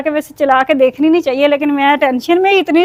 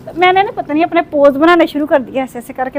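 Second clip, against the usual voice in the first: ordinary talk, nothing marked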